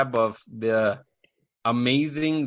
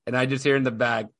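ordinary delivery

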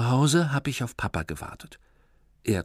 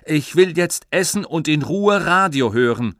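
loud, raised annoyed voice; irritated father; 6 semitones higher, 8 dB louder than usual. Acted. Father shouting to hear radio in peace, loud. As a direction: brush the boy off loudly.

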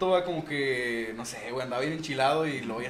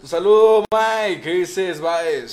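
loud, raised shout-out; saludo a gritos; 3 semitones higher, 12 dB louder, much slower than usual. Real, not acted. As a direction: call out a greeting at full volume. (saludo gritado, fuerte y agudo)

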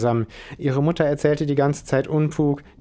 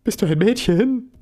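much higher, slow, stammering shock; startled nerd stammering at the girl; 9 semitones higher, 5 dB louder than usual. Acted. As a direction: caught off guard, sputter it out. Chris startled by intruder.

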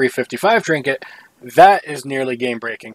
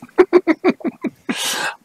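high giggle. Real, not acted. Laughing at gnome voice.

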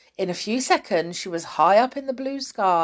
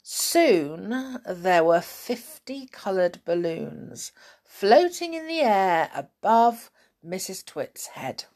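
plain reading voice

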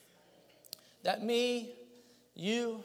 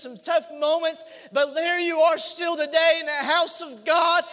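loud, high impassioned shouting; preaching at full force; 9 semitones higher, 11 dB louder than usual. Acted. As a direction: build to a roaring altar call.

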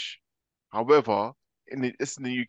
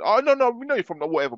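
high, louder mock voice of a prying stranger; mocking nosy questioner; 10 semitones higher, 6 dB louder than usual. Mimicking the locals who ask where he's from, high and mocking. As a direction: send up the pushy asker.

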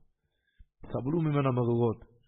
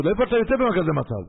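alarmed rebbetzin; 9 semitones higher, 7 dB louder, faster than usual. Retold or imitated. Rebbetzin alarmed, questions husband.

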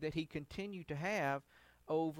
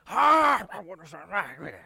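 loud careless exclaim, then quick mumble; a hint of a brash Samson; lightly voiced cocky strongman; mock Samson; 12 dB louder than usual.

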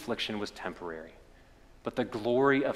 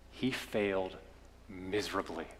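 softer, letting failed miserably sink low and quiet; hushed, falling away; 4 semitones lower, 10 dB quieter than usual. Acted. Preacher tells the congregation of Peter's failure, hushed. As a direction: say it like a sad confession.

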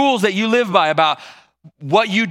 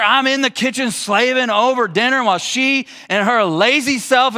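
higher-pitched, indignant acted outburst; upset, fed-up complaint; 5 semitones higher, slower than usual. Martha demanding Jesus take her side, fed up. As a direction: plead unfairness, stretching the key words in exasperation. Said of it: "Martha's so upset. She's so fed up".

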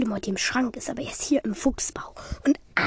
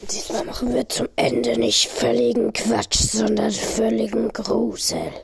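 spooky announcer; 7 dB louder, slower than usual. Acted.

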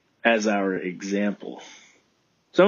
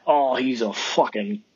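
high, bright-eyed listener's voice; a lightly voiced Australian listener checking him out; curious listener voice; 4 semitones higher than usual. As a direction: act out a curious stranger's first take.

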